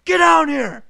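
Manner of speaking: high, loud shouted order; imitated foreman's yell. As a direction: order someone down from far below. Angry foreman ordering him down.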